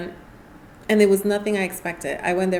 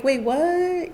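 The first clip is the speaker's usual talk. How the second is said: stunned surprise; 9 semitones higher than usual. Retold or imitated. Learns volunteering costs money.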